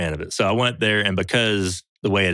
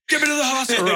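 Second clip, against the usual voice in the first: high urgent demand; frantic call for help, voice way up; hammed-up frantic plea; mock panic. Retold panicked call to production.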